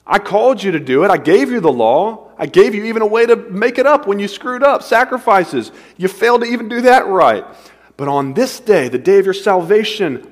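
an exasperated God venting at Israel; God rebuking. Pretend.

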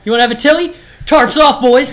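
loud, high mock hockey brawler; rowdy fight challenge. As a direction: holler to the boys as if starting a brawl.

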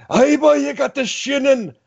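high, put-on Scottish accent; Scottish groundskeeper impression. Fan imitates the Scottish groundskeeper in a high Scottish accent. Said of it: "crazy Scottish groundskeeper guy".

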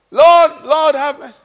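high, loud plea that drops away; acted cry for mercy; imitated crying out. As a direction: call out like a beggar pleading for mercy. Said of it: "He didn't cry with them".

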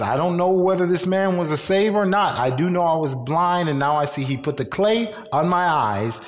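excited testimony. Retold or imitated. Blind man's healed testimony.

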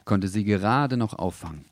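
ordinary delivery

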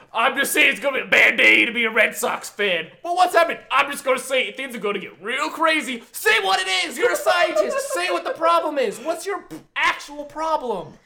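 high exasperated shouting at the character. Retold or imitated. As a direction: yell in disbelief at the screen. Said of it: "it's like".

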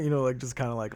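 usual speaking voice

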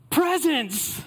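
put-on high excited child blurt; excited kid shout. Child blurting answer, retold.

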